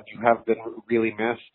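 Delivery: ordinary talk, nothing marked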